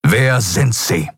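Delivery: "Wer sind Sie" slow, every word cut like an axe blow; each word stressed sharply. A narrator's reading. Admiral interrogates the prisoner, "Wer sind Sie" cuttingly stressed. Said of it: "betonte jedes einzelne Wort mit der Schärfe eines Henkerbeils".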